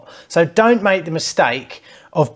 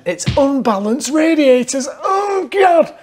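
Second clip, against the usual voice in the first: put-on Northern accent; 9 semitones higher, slower than usual. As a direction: shout it in a broad Northern accent. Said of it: "I'm gonna do this one in Northern accent".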